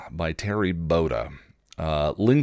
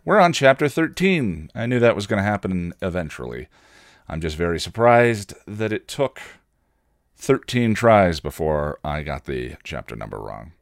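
ordinary delivery, nothing marked

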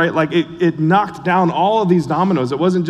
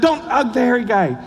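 grandma's warning; 9 semitones higher than usual. Retold or imitated. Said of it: "be like".

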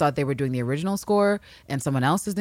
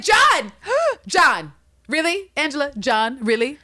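high, louder shocked exclamations; shocked John reaction; 9 semitones higher, 6 dB louder, slower than usual. Retold or imitated. Viewer reacts to the name reveal with mock shock. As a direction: gasp and cry out the name in disbelief. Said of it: "And I was like".

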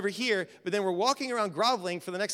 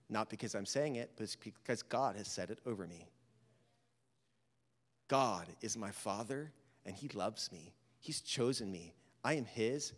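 quiet affirmation; 10 semitones lower, 9 dB quieter than usual. Acted. Soft declarations of identity.

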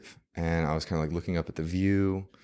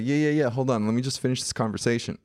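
higher, quick retold "hold on"; mock-absorbed, hurried and pitched up; hurried brush-off; 6 semitones higher, faster than usual. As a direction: wave a friend off mid-conversation. Retold putting off a friend.

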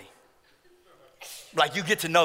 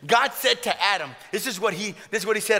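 ordinary delivery

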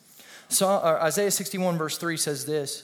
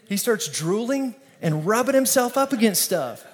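usual speaking voice

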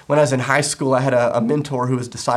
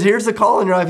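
higher and louder, lifting the call; excited preaching; 7 semitones higher, 5 dB louder than usual. Shown as a demonstration. Pastor voicing Paul's call to the church, excited. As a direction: raise the voice high and bright to announce it.

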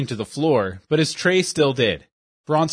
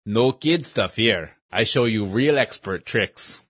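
put-on slow, lower boast in a broken-English accent; proud old magician in broken English, slowly; 4 semitones lower, slower than usual. Shopkeeper boasts before sword trick.

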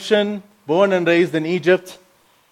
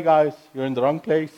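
usual speaking voice

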